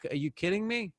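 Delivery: high-pitched mock disbelief; incredulous. Gym-goer reacting to the love music, incredulous.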